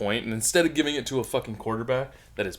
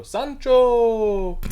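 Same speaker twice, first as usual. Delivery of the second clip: teasing shout; 10 semitones higher, 9 dB louder than usual. Retold or imitated. Coworkers shout at sneezer.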